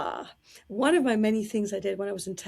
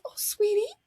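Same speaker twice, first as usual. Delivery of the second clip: much higher, sweet gushing voice; sugary diva; 13 semitones higher, 6 dB louder than usual. Retold or imitated. Diva greeting the fan in line, high and sugary. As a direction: coo it like a charmed star. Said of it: "She goes".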